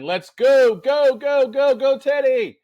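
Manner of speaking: loud, high workout yell; motivational yelling. Retold or imitated. Runner yelling to get his dog sprinting, loud motivational yell. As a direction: urge the dog into a sprint at full voice. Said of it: "when I start yelling".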